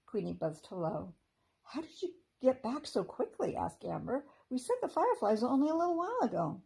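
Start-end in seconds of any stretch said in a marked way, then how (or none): 1.6-6.6 high, quick fairy voice. A narrator's reading. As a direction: chatter like a small fairy. Amber's fairy voice.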